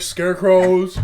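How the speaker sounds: Scarecrows repeated loudly in a mock-solemn tone; mocking echo. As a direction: parrot his Scarecrows to mock him. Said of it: "mocked him".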